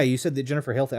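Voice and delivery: ordinary talk, nothing marked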